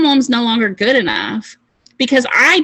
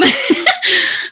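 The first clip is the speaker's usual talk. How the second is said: bright burst of laughter, higher; 7 semitones higher than usual. Real, not acted. To her team after teasing Coy, high laugh. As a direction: laugh it off mid-pitch.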